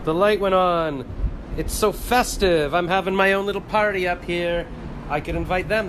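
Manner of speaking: delighted. Real, not acted. Delighted light turned on.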